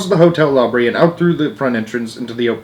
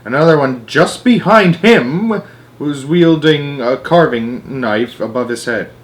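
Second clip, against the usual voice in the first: ordinary delivery, nothing marked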